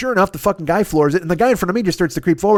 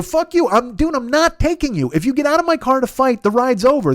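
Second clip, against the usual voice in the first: higher, exasperated retelling of an argument; indignant refusal; 6 semitones higher than usual. Retold or imitated. Driver refuses to keep taking passenger, indignant. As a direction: lay down the law, fed up.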